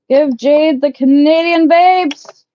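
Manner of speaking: high, loud, slow call-out; loud slow playful demand. Real, not acted. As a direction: drag it out like a chant to the crowd. Calling out demand to chat.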